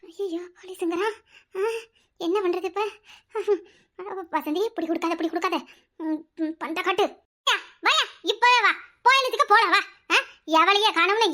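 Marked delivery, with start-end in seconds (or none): 0.0-2.8 soft, slow worried aside to herself; frightened aside. Acted. Woman alarmed when the old man mentions the police, soft and slow. 4.3-7.0 put-on soft, hushed words to the girl; hushed warning. Woman secretly hushing Vasanthi while the man waits outside, softly.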